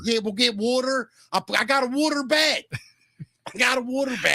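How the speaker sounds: high, hammed-up Baltimore woman; Baltimore accent. Host as Baltimore woman meeting Mickey, high Baltimore accent. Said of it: "the Baltimore accent".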